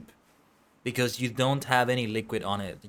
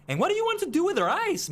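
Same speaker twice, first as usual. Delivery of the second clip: incredulous; faster than usual. Real, not acted. Questioning a suspicious quest giver.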